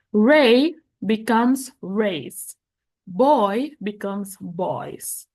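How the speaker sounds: very slow, clear example read; slow teacher demo; slow model reading. Shown as a demonstration. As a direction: spell it out slowly for students. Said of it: "reading examples to class".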